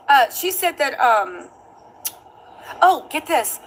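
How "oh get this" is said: normal talking voice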